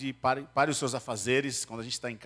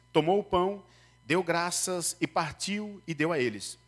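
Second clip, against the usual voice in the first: higher, solemn Bible reading; scripture reading; 6 semitones higher, 3 dB louder than usual. Acted. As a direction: read the passage to the congregation. (leitura bíblica solene)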